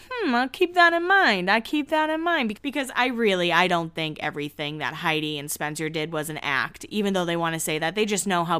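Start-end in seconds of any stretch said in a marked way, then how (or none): 0.1-2.5 skeptical. Retold or imitated. Skeptical reply to cast member.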